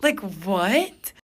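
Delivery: high, loud incredulous question. Retold or imitated. Woman reacting to date's life advice, incredulous. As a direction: burst out in disbelief.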